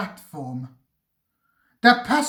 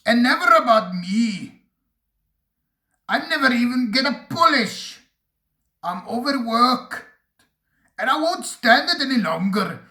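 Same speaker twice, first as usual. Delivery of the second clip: angry complaint; 5 semitones higher, faster than usual. A narrator's reading.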